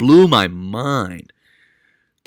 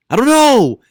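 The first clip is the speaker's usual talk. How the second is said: loud, high-pitched exclamation; exasperated outburst; 9 dB louder, slower than usual. Real, not acted. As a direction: burst out in baffled exasperation. Exasperated outburst at own speculation.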